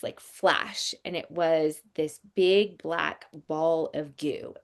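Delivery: ordinary delivery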